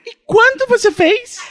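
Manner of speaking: high, slow put-on voice; high squeaky customer. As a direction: ask like a squeaky tourist.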